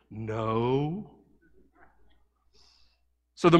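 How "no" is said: put-on small, hushed child's hello; a timid kid picking up the phone, hushed; quiet little boy. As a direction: answer softly like a shy little boy. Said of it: "real quiet and soft".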